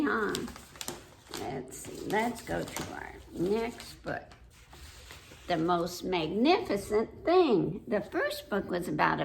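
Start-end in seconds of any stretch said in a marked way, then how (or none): none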